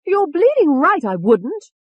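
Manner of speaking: high, loud, brash retort; angry alter personality. A narrator's reading. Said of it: "The face and voice changed again".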